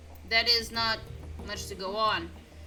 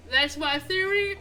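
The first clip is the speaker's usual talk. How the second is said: yawning; 6 semitones higher, 5 dB louder, slower than usual. Real, not acted. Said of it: "I'm sorry for yawning".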